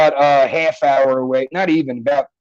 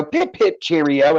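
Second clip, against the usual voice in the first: stock British accent; slower than usual. Shown as a demonstration. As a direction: imitate a cliché Brit. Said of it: "people do British".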